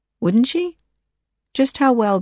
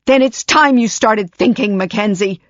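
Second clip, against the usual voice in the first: stern rebuke; 5 semitones higher, 5 dB louder than usual. Acted.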